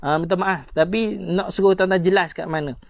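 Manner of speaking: normal talking voice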